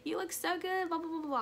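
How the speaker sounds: put-on high, loud gushing girlfriend voice; squealy friend imitation; bubbly friend. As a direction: gush like an excited friend greeting you. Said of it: "she was like".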